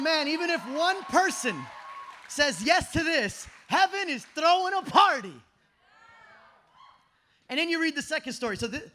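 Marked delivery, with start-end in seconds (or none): 0.0-5.4 loud, rising, slowing on "says yes to this"; excited shout. Retold or imitated. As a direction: call it out like joyful news. Preacher voicing Jesus' joy.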